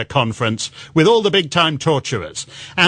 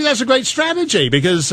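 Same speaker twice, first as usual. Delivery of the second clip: ordinary delivery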